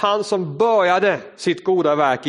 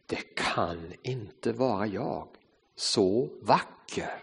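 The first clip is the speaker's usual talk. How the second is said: slow, much softer and lower in awe; awed whisper-soft; 6 semitones lower, 11 dB quieter, slower than usual. Acted. Teacup seeing its reflection, soft and slow in wonder. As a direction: barely breathe it, amazed at yourself. Teacup sees itself in mirror.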